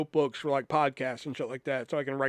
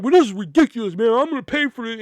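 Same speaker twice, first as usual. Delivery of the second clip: high, loud indignant griping; fuming customer; 8 semitones higher, 9 dB louder than usual. Retold or imitated. Customer caving and paying, loud indignant complaint. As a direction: grumble it loudly as you give in.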